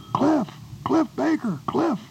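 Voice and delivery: high, carrying call across the street. Retold or imitated. Conrad calling after Cliff down the street, high carrying call. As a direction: call his name to stop him from a distance. Old friend calls him from afar.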